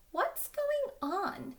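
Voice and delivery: high, slow wondering voice; puzzled puppy. Acted. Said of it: "Clifford wondered".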